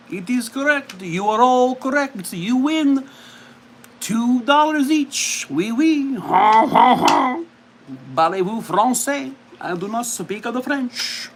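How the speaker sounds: high-pitched, exaggerated French accent. Announcing winners of the movie game, hammed-up French accent. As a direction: play an over-the-top French game-show host. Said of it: "I should not have done the accent".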